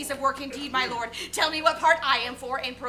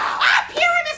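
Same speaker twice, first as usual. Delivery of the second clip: high shriek; 14 semitones higher, 6 dB louder than usual. Acted. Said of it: "terrible, monstrous voice".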